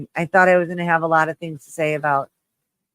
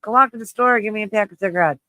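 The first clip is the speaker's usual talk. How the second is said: higher, louder bossy order; grandma yelling; 7 semitones higher, 6 dB louder, faster than usual. Retold or imitated. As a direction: bark out a grandma's errand. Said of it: "my grandma being like".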